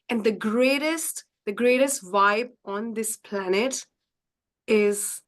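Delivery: ordinary delivery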